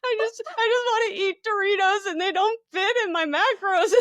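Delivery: high, tearful wail of self-pity; crying whine. Retold or imitated. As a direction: whimper it like a frustrated crier near tears. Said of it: "literal tears were coming out".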